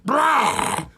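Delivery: high strained grunt of effort; mock grunt. Retelling the man in the bathroom, high mock grunt. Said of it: "you hear him go".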